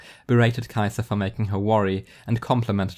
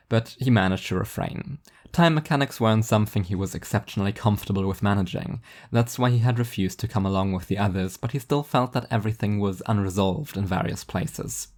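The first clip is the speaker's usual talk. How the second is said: usual speaking voice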